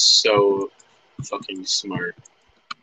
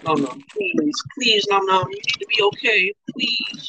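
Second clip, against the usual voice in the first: desperate pleading; 12 semitones higher than usual. Real, not acted. Begging game healer.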